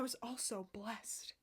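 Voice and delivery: unvoiced reverent whisper. Real, not acted. Vlogger whispering about her dream boot.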